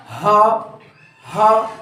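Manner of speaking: demonstrating हा for students; breathy ha demo. Shown as a demonstration. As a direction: breathe out हा from the back of the throat. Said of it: "ये हलक के निचले हिस्से से अदा होगा".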